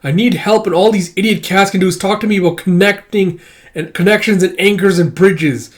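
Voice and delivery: high, loud exasperated complaint; fed-up rant. Acted. Demanding instructions from the idiot cats, loud and fed up. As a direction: snap at the useless cats. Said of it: "It's like".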